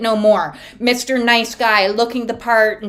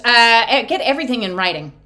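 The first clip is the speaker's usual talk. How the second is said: ordinary talk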